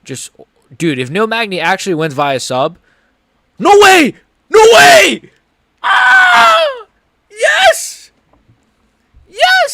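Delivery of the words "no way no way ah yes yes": ecstatic high-pitched scream. Real, not acted. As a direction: scream with pure disbelieving joy. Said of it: "I screamed so loud at the mic".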